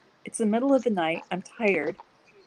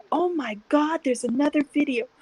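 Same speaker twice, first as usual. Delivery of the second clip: excited; 7 semitones higher than usual. Retold or imitated. Retelling video flood, rising pitch.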